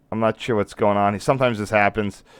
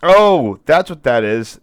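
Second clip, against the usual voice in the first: surprised; 5 semitones higher, 6 dB louder, slower than usual. Real, not acted. Sudden realization.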